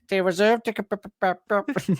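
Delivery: high, stammering mock Welsh; playing the idiot. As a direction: stutter like a dim suspect. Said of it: "pretend that you're an idiot or Welsh".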